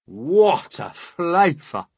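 slow, loud, high exclamation; crying out. A narrator's reading. Centipede with a mouthful of peach, crying out. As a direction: cry out, relishing each word. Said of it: "the centipede cried".

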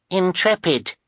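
put-on high little-girl voice; high-pitched toddler character; small child's voice. A narrator's reading. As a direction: pipe up as a tiny child. Narrator voices baby Sunny.

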